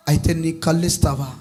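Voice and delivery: low, soft, grave question; hushed, serious offer; acted doctor's question. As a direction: ask it gently, voice dropped.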